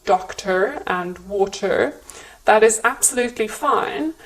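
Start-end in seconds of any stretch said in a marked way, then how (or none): 0.0-2.0 "doctor" and "water" with a sounded final R; American R endings. Shown as a demonstration. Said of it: "you do pronounce the R sound".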